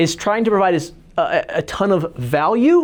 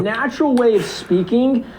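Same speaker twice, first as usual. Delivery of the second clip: over the top; 5 semitones higher than usual. Shown as a demonstration. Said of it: "It's gotta be over the top".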